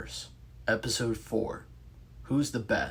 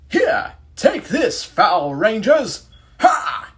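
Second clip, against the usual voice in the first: loud, high, slow British knight villain; British accent villain; 12 semitones higher, 13 dB louder, much slower than usual. Acted. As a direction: boom it out as a British-accented medieval monster. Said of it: "speaks in a British accent".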